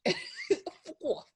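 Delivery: high squeal of a laugh through it. Real, not acted. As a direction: laugh the words out. Echoing co-host's retort, laughing.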